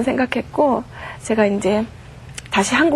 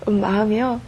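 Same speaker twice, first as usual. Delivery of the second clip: ordinary delivery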